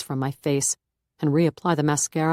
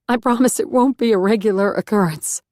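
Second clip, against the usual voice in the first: louder, forced laugh through tears; teary laugh; 5 semitones higher, 6 dB louder than usual. A narrator's reading. Beth reassuring the reverend, forced teary laugh. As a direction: laugh it off while still crying. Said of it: "I force up a throaty laugh".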